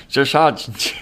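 "Josh Sargent" drawn out slowly through a laugh; relishing "Josh Sargent", slow and giggling; laughing name. Shown as a demonstration. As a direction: laugh while you stretch "Josh Sargent". Friends savouring the striker's name.

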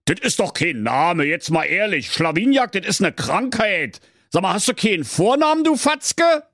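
high, fast mock rant; ranting king. King mocking his servant's name, high and blustering. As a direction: scold him like a blustering king. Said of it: "tobte der König".

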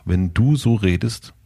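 measured mock boundary-setting; imitated calm refusal. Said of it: "sagt einfach".

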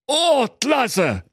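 high, naive cheer; delighted fool. Acted. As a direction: rejoice like a simpleton, pitched high. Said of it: "freute sich der Thorsten". (hoch und erfreut)

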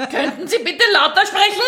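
high, laughing mock request; mock bank clerk. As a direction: call back as a baffled clerk, amused. Acts out bank clerk unable to hear.

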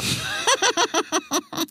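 high gleeful villain cackle; villainous laugh. Acted. Aisha gloating over her plan, high cackle. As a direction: cackle like a lioness pleased with herself.

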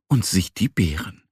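plain reading voice

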